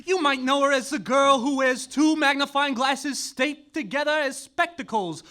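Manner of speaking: ordinary delivery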